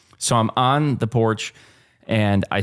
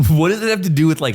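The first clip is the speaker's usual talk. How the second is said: normal talking voice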